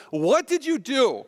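imitated shock, high and drawn out; slow, high-pitched mock accusation; appalled demand. Wife seeing husband's mess, retold appalled reproach. Wife sees the tangled hair.

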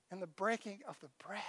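hushed and reverent, letting bread fade softly; hushed reverence. Acted. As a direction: drop almost to a whisper on the breaking of the bread. Solemn emphasis on the bread.

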